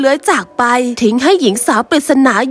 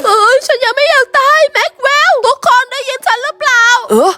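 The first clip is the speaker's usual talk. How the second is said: higher, frantic calls for help; panicked; 6 semitones higher than usual. Acted. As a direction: shout to friends in fear. Soldier panics calling friends. (ตื่นตระหนก เสียงสูง)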